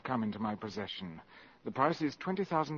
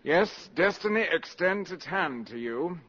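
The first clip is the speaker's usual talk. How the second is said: grand pronouncement; 6 semitones higher, 6 dB louder, slower than usual. Acted. Spy makes grand pronouncement.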